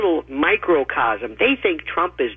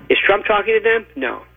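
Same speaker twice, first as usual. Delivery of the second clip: normal talking voice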